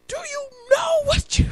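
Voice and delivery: very high, exaggerated outrage; mad cop. Cop turning red at the pedestrian, mad mock yell. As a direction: squawk it in mock fury. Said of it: "The cop is turning red, mad".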